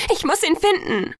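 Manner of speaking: high, urgent desperation; frantic. A narrator's reading. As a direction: insist frantically, pitched high. Hysterical, breaking free to search. (panisch drängend, mit hoher Stimme)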